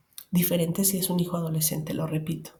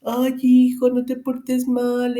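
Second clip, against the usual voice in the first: mock tearful submissive mom; 5 semitones higher, 6 dB louder, slower than usual. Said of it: "esa mamá ahí sumisa, llorando".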